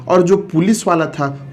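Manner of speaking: normal talking voice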